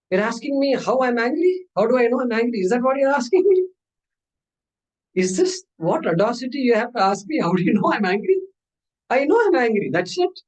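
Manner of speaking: indignant, high-pitched disbelief; high, affronted retort of an angry patient; acted angry. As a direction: throw the question back in mock outrage. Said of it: "he became angry. And so angry".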